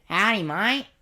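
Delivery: high, loud Aussie bloke greeting; exaggerated Australian accent, high-pitched; broad Australian accent. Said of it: "most Australian guy you could ever meet".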